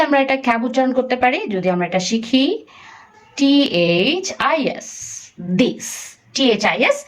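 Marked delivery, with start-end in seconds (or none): 3.4-7.0 drawn-out letter-by-letter spelling; slow spelling. Shown as a demonstration. Teacher spelling a word slowly for a child learning to read. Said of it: "এটা যদি কয়েকবার সে পড়ে".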